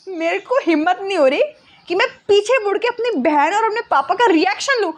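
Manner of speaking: ordinary delivery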